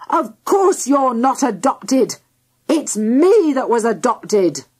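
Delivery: slow, emphatic father's yell; measured, exasperated outburst. A narrator's reading. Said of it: "Suddenly he yelled, in a voice even louder".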